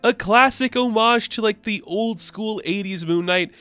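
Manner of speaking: high-pitched, gushing enthusiasm; real excitement. Real, not acted. Reviewer cheering on the comic book, excited. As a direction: champion it with your pitch way up.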